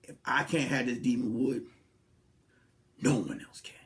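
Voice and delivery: low, soft, ominous vow; mock menacing whisper; menacing woman. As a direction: threaten quietly like a scorned lover. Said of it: "she be like".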